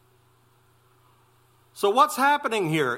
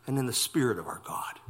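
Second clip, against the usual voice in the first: sudden whisper; 7 semitones lower, 7 dB quieter, faster than usual. Acted. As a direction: drop to a quick whisper. Verse reading ends in hush.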